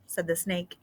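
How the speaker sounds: put-on lively read-aloud for young children; story reading. Teacher reading a picture book to students, lively storytelling.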